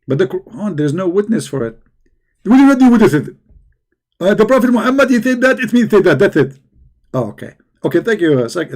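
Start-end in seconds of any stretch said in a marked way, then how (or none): 2.4-6.6 high, loud preachy mimic; Zakir Naik. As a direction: lecture like an excited preacher. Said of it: "thank you, Zakir Naik".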